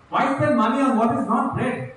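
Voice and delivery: "spend" lifted high and firm; scripture reading. Acted. Preacher reading the verse aloud, pressing.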